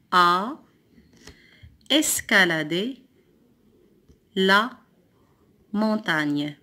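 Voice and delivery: long pauses between a, escaladé, la, montagne; word by word. Shown as a demonstration. Teacher cutting the sentence into words, word by word. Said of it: "Le deuxième mot".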